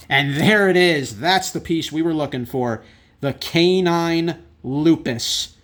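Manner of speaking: loud, thrilled reveal of the pull; excited. Real, not acted. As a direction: cheer the big card as it appears. Key card finally pulled.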